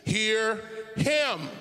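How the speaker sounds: loud, drawn-out divine proclamation; emphatic voice of God. Acted. A voice from the cloud speaks to the disciples, booming emphatically. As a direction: thunder it out slowly, each word weighty. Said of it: "God was not whispering".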